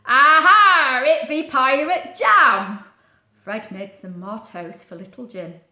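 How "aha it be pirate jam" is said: loud, slow pirate bellow. Acted. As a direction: roar it like a proud old pirate. Fred proudly announces his jam.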